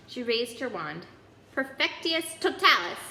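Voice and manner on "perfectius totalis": a spell cried out, louder and higher. A narrator's reading. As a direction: cast it with force. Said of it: "she cried".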